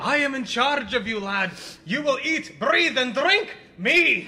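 hammed-up Scottish boss, lording it over the lad; Scottish accent. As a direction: play the bossy Scots superior, half laughing. Boss bosses around the recruit.

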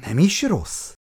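plain reading voice

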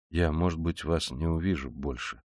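low, subdued, sombre; quiet gloomy farewell. A narrator's reading. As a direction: drop pitch and volume, sullenly. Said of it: "угрюмо продолжал Раскольников".

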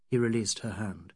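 ordinary delivery

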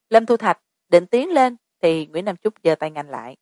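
ordinary delivery, nothing marked

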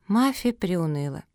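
usual speaking voice

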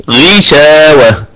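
very slow غشاوة with a light غي; corrected recitation. Shown as a demonstration. Teacher correcting the class's غشاوة, slow recitation. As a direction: say غشاوة slowly, غ with a thin i. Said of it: "لا، غي".

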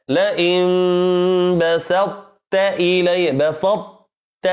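"بسطت" very slow, emphatic ط held, then t; heavy ط in بسطت. Shown as a demonstration. Teacher showing students the heavy ط of بسطت. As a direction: fill the mouth on the ط of "بسطت". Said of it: "تملأ الفم بالإطباق".

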